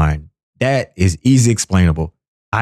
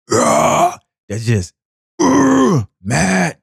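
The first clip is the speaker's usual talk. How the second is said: mock angry grunt; 9 semitones higher, much slower than usual. Acting out angry music.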